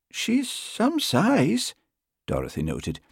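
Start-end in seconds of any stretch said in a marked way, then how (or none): 0.1-1.7 high, drawn-out island lilt; soft Western Isles lilt. A narrator's reading. Constable eyeing the big cat, soft Western Isles lilt. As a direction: remark slowly with a Hebridean lilt. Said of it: "in a soft voice delicately laced with an endearing lilt".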